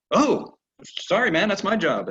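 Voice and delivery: high, slow, sheepish horse voice; playing the horse who cheerfully owns his job; voicing the horse. Owner voicing her horse as an eager, apologetic partner, high and sheepish.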